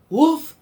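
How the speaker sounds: high loud bark sound; playful woof. Acted. Dog barks back at Duck, high and loud. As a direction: bark it out for the kids.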